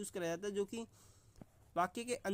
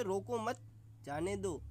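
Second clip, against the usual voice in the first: रोको मत as one raised phrase, a break, then falling जाने दो; pause after मत; slower than usual. Shown as a demonstration. Teacher repeats the example about a politician's car, pausing after मत. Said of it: "दूसरे option की बात करें".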